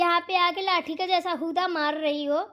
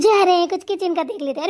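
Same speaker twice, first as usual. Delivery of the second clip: loud, sneering parrot of her words; mocking echo; 3 semitones higher, 9 dB louder than usual. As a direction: throw her own line back at her, jeering. Sister-in-law mocks bahu's words. (ज़ोर से चिढ़ाते हुए नकल)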